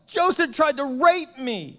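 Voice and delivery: high, loud, drawn-out fake cry; mock yell for help. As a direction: cry out as the accusing wife, hammed-up and stretched. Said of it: "she literally yells out".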